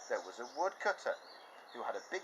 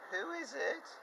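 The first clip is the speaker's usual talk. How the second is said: much higher fake granny voice; wolf as Grandma; slower than usual. Wolf disguised as grandma, high fake voice. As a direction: pretend to be a frail grandma. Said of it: "And the wolf said".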